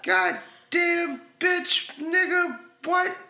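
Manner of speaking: high, loud startled shout; startled yell. Real, not acted. Man yelling at his girlfriend in alarm, loud. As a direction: yell out in shock at the intruder.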